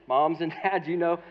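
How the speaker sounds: ordinary talk, nothing marked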